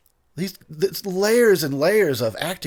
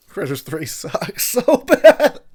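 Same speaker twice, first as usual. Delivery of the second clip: laughing; 9 semitones higher, 8 dB louder, much slower than usual. Real, not acted. Mocking a bad movie.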